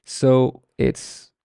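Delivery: normal talking voice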